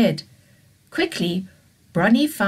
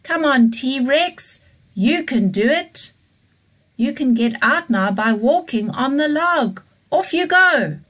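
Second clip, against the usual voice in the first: cheering him on; 8 semitones higher, 6 dB louder than usual. Acted. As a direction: urge him up the log. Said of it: "they shouted".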